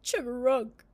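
loud, high croak with a cry in it; crying frog croak. Acted. Frog crying out as the woman holds him. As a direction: croak like a frog about to weep. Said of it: "the frog began to cry and said".

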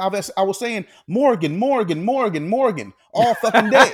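worked-up imitation of a fed-up man; exasperated insistence. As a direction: insist with mounting exasperation, hammering the repeated name. Retold exasperated man insisting he called.

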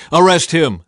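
loud high command; a king commanding his guards; ordering. A narrator's reading. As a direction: bark the arrest order. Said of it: "the king of Israel ordered".

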